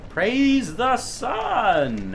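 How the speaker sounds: high, slow, stretched cry; exultant call. Real, not acted. Gamer celebrating progress, exultant call. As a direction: draw it out like a jubilant chant. Game catchphrase called out.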